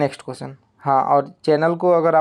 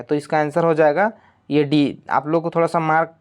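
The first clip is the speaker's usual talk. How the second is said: ordinary talk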